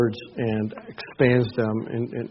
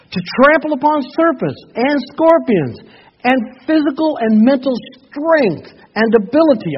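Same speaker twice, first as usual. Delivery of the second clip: high, loud proclamation; full-force preaching; 12 semitones higher, 8 dB louder than usual. Acted. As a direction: declare it over the congregation. Reading scripture at full force.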